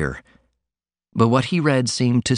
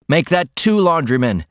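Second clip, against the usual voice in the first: abrupt; 6 semitones higher than usual. Acted. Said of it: "he said abruptly".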